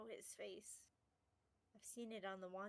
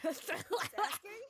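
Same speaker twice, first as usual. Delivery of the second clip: loud; 10 semitones higher, 14 dB louder than usual. Acted. Character challenges intruder.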